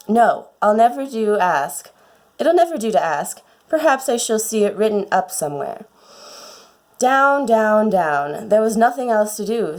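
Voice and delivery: plain reading voice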